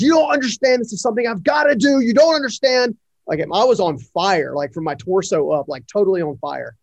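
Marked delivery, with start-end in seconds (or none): 0.0-3.0 retold high-pitched yell of defiance; screaming. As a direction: shout back at your father, pitched way up. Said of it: "I began screaming almost at the top of my lungs".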